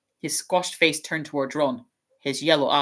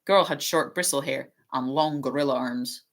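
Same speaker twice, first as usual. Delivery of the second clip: ordinary delivery, nothing marked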